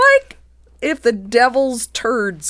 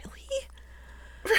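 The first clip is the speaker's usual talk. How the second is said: breathy incredulous whisper; whispered thought; 14 dB quieter than usual. Retold or imitated. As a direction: whisper it to yourself in disbelief. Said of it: "And I'm thinking".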